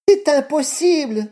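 high, loud terrified scream; terrified horror victim. Acted. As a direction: shriek it in sudden terror. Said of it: "vociféra la belle-mère en s'enfuyant".

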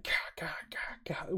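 hushed, breathy stab sounds; imitated stabbing noise. Player retelling Ellie's knife attack, whispered stabbing noises. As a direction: mime repeated stabs with harsh whispered bursts.

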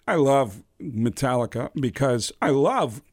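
slow, halting Walken cadence; Christopher Walken impression. Prank caller greeting Lars as Christopher Walken, slow and halting. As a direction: drag out each phrase like Walken. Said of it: "Can you say hi to Christopher Walken".

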